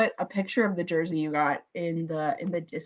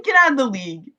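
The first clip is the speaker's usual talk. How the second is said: loud, higher scornful retort; mock dismissal; 5 semitones higher, 7 dB louder, faster than usual. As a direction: send them packing, louder and higher. Acted retort to blasé players.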